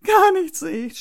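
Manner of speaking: put-on high, loud tearful child; upset small boy's whimpered complaint; sobbing protest. A narrator's reading. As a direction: wail it like a crying little boy. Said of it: "schluchzt Florian".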